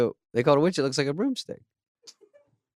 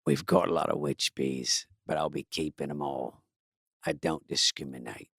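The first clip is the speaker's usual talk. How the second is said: much lower, slow tough-guy voice; beekeeper voice; 9 semitones lower, 8 dB quieter than usual. Acted. Host voicing the beekeeper meeting the bee, low slow action-hero voice. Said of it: "oh, the beekeeper".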